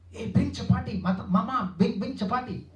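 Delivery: mock childlike calling for chapati; repeated begging call. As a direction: plead like a child calling for mother. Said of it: "Two, three times repeatedly, he speak like that".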